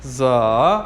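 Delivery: जा low, dipping then rising; third tone. Shown as a demonstration. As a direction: dip जा low and let it climb. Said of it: "हल्की आवाज़ से शुरू करेंगे और भारी कर लेंगे". (जा को हल्की आवाज़ से शुरू कर भारी करें)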